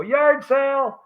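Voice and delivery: high, loud, drawn-out mock holler; mock call-out. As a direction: call it out like skiers teasing a wipeout. Imitating skiers calling out after a crash.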